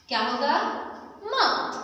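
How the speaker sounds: normal talking voice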